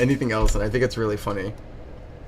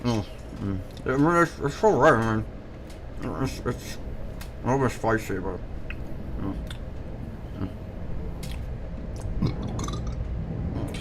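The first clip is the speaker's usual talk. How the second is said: chewing sounds between words; munch and hum through it; chewing noisily; 7 dB quieter than usual. Pretend. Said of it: "Does it sound convincing?".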